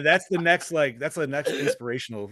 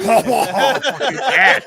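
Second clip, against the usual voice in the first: mock outburst while laughing; 9 semitones higher, 7 dB louder, slower than usual. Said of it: "and be like".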